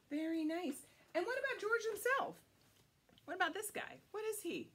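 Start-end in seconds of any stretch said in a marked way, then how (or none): none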